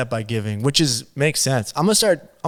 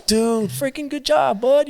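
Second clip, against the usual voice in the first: proud praise; 10 semitones higher, slower than usual. Retold or imitated. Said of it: "I'm like".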